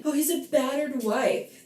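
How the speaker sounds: mock his whiny claim; sarcastic impression of the friend; mocking quote. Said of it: "always talking shit about Katie".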